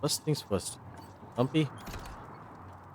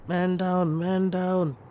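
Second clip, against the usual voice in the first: mock soldier callout; 9 semitones higher, 3 dB louder, slower than usual. Calls out a casualty.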